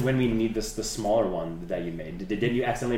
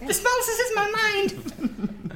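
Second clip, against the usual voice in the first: squeaky rubber duck; slower than usual. Acted. Still in tiny horse voice.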